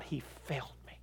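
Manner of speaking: breathy whisper, barely voiced; whispered grievance. Woman whispering her grievance to the pastor. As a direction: let it die into a whisper.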